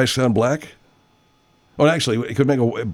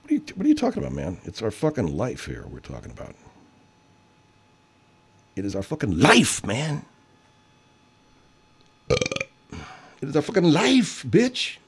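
imagined retort; 5 dB quieter than usual. Retold or imitated. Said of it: "What I should have said was".

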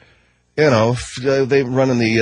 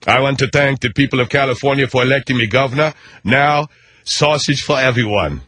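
mock Arnold speech in a thick Austrian accent; Arnold Schwarzenegger impression. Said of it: "you got a German accent".

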